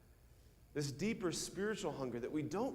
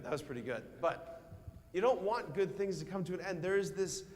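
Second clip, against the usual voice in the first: usual speaking voice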